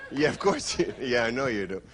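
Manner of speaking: ordinary delivery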